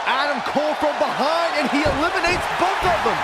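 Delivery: rising play-by-play call, pitch high; excited call. Acted. Commentator calling a double elimination, excited and high. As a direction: sell the big moment.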